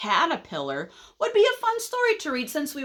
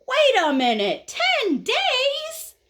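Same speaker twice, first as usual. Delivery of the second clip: high, loud incredulous outburst; shocked disbelief; 4 dB louder, slower than usual. Acted. Caterpillar realizes the long wait, shocked. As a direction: shoot up high on the question in shock. Caterpillar learns it takes ten days.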